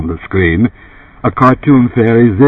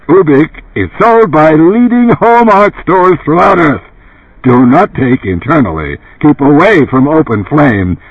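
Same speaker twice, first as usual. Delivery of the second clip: raised, emphatic commercial delivery; dark-voiced pitchman; 7 semitones higher, 3 dB louder than usual. A narrator's reading. Runsider urging Joe to buy Ubik, emphatic TV pitchman. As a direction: press the viewer to buy. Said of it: "Runsider's dark voice resumed".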